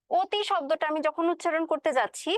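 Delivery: ordinary talk, nothing marked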